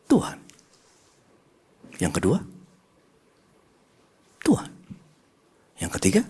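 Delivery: soft, quiet back-and-forth, answers hushed; Holy Spirit gently questions the pastor at bedtime, soft replies; gentle dialogue with God. Retold or imitated. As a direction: let each answer fall softly. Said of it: "Tiba-tiba Roh Kudus bicara".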